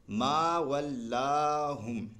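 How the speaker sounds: ما ولاہم recited very slowly with ما and لا held long; slow tajweed recitation; stretched ma and la. Shown as a demonstration. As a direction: hold ما and لا long, chanting. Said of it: "میم کھنچا ہے اور لام کھنچا ہے".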